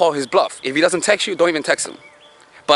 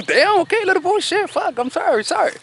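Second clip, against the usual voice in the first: much higher, teasing fake sorry; playful mock apology. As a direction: pretend to be scared of a little boy. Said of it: "you react in a playful way".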